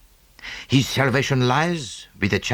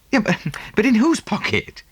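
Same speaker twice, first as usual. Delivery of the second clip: higher, tripping over the words; bewildered question; 11 semitones higher than usual. Acted. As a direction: press him, baffled. Still bewildered, pressing Poirot.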